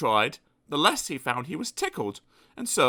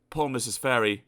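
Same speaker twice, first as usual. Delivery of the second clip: ordinary delivery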